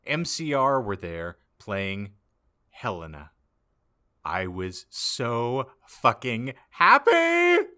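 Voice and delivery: normal talking voice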